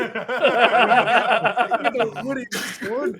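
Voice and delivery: high, very loud drawn-out cry through laughter; loud boast. Real, not acted. Player boasting of one-shotting a beast, loud and laughing.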